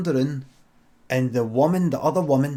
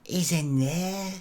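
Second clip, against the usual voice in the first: slow, higher Blackpool woman's voice; Blackpool accent; 4 semitones higher, 3 dB quieter, slower than usual. Acted. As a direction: draw it out in a put-on Blackpool accent. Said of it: "is that a Blackpool accent you've got".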